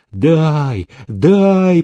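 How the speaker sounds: insistent calls, climbing in pitch; crowd's cry. A narrator's reading. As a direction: demand it, raising the second cry. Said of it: "закричали все разом".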